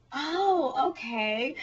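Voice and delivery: drawn-out high "Oh", then settling; mock sudden understanding; retold realisation. Reacting to Jessie's correction, mock dawning realisation.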